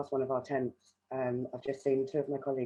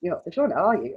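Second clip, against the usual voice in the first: teasing with a smile; 4 semitones higher, 8 dB louder than usual. Retold or imitated. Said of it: "I just laughed and smiled at her".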